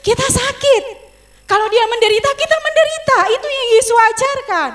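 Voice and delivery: loud, high, fervent preaching; preaching at full force. Acted. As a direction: drive the scripture home to the congregation.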